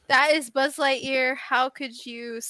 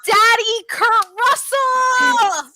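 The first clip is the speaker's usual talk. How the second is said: high, slow, drawn-out excited cry; excited exclamation; 7 semitones higher, 4 dB louder, slower than usual. Real, not acted. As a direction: savour the name with delight. Excited shout about actor.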